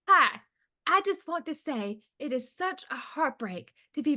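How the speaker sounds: put-on a light Idaho accent, sweet and earnest; a hint of a sugary Boise accent. Joanne Vacho in Boise, Idaho, lightly put-on Idaho accent. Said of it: "Can you do her accent?".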